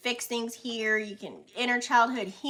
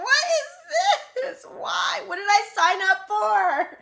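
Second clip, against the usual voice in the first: mock panic; 11 semitones higher, 8 dB louder than usual. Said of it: "you'll be looking like".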